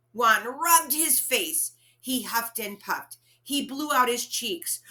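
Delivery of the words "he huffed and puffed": usual speaking voice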